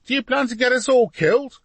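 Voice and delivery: put-on very high, loud boy's scream. A narrator's reading. Boy shaking his papa's scaffold, screaming. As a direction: scream up at him for attention. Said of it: "he screamed".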